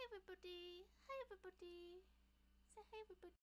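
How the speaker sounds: high, soft coaxing voice. Real, not acted. Streamer coaxing her shy pet to greet chat, high and soft. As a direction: coax a shy pet out of hiding.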